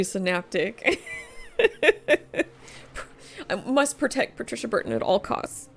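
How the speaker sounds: ordinary delivery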